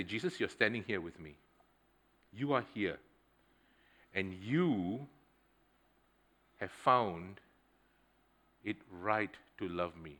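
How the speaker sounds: quiet prayer. Retold or imitated. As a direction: pray softly in your head. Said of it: "in my mind, I say".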